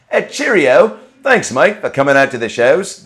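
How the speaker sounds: cheerful mock Australian thank-you; mock Australian accent. Comedian thanking London fans in a hammy Australian accent. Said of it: "That's Australian".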